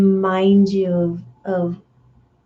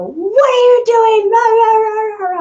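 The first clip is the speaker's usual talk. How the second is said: much higher, louder mock outburst of rage; snapping at your person; 14 semitones higher, 6 dB louder than usual. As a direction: lash out in a hammed-up fit. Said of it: "just go".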